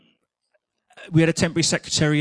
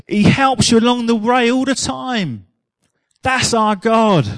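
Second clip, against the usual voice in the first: slow, louder, lifted praise; fervent preaching; 4 semitones higher, 4 dB louder, slower than usual. Real, not acted. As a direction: preach it with warmth and build. Preaching at full force.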